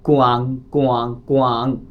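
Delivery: कुआंग said slowly with a nasal ending, drawn out; Chinese word demo. Shown as a demonstration. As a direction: sound out कुआंग carefully for students. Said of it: "For मैं example देता हूँ". (चीनी शब्द कुआंग धीरे-धीरे बोलकर दिखाना)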